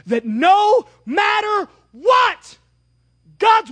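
sudden loud, high, slow shout; preaching shout. Real, not acted. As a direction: belt it out from the pulpit. Preacher bursts out mid-sermon.

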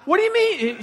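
high, loud indignant comeback; mock angry scream. Husband arguing with his wife, screaming back. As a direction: scream back in hammed-up outrage. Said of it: "he screams back".

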